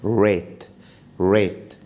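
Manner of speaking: «ريت» with lips rounded; English R. Shown as a demonstration. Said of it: "قول عليها ريت".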